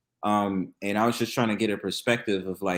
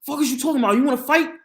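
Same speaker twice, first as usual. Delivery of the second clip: much higher, louder mock yell; aggressive stranger; 5 dB louder, faster than usual. Guy at the back of the light rail, loud mock challenge. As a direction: throw it back like a hothead picking a fight. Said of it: "He's like".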